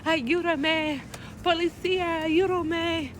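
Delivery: high, thin mock plea for help; hammed-up plea for help to passing police; mock cry for help. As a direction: beg the police in a fake panic. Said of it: "urge to be like".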